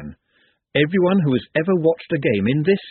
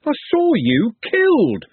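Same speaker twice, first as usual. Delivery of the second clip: high, slow, loud cry of disbelief; loud and high-pitched shout; 12 semitones higher, 4 dB louder, slower than usual. A narrator's reading. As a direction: shout it out, still shocked he's alive. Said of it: "Trev shouted".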